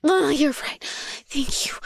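ordinary talk, nothing marked

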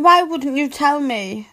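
ordinary delivery

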